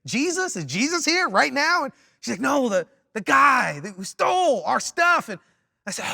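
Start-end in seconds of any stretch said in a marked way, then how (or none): 0.1-1.8 high-pitched mock bewilderment; waking-up confusion. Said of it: "I'm, like, waking up". 2.4-5.3 loud, high imitation of an insistent wife; slow down on the last words, hammed-up impatience; exasperated correction. Wife's exasperated correction, retold.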